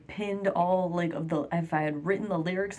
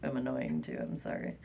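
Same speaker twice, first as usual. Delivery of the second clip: much lower, softer self-mocking mumble; meek apology; 7 dB quieter than usual. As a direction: shrink into a small apologetic mutter. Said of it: "or should I just be like".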